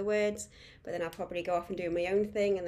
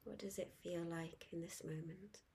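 guided meditation; 9 dB quieter than usual. Acted. Guide inviting listeners to focus on the breath, soft meditation voice. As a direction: ease them gently into calm, letting each phrase settle.

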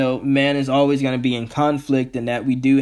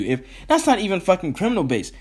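ordinary talk

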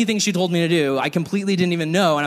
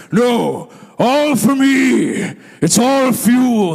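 loud, slow, booming higher voice; booming pastor; 5 semitones higher, 5 dB louder, much slower than usual. Retold or imitated. Pastor boasting the burgers are all his, booming. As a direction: boom it like a proud big eater. Ken answering about burgers.

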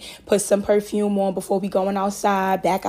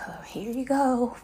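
unvoiced mocking whisper; sarcastic whisper; 2 semitones higher, 5 dB quieter than usual. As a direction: hiss it under your breath. Handing bag to security.

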